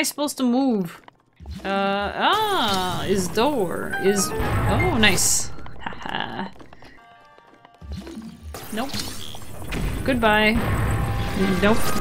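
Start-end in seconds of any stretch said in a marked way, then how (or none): none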